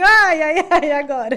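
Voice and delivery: high, loud cries of pain; mock labour yelps, laughing; loud retold moan of pain.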